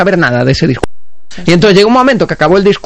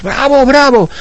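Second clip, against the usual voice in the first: crowd cheering; 8 semitones higher, slower than usual. Retold or imitated. Audience cheering the impostor.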